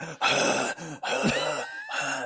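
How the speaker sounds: heavy snorting dog breath; bulldog panting. Shown as a demonstration. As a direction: pant hard like a bulldog. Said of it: "That's my limited bulldog impression".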